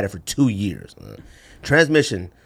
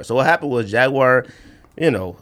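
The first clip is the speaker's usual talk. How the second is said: ordinary talk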